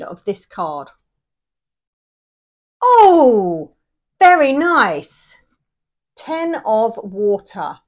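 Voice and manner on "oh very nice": loud, slow, delighted oh. Real, not acted. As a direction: savour the good card. Delighted at the card.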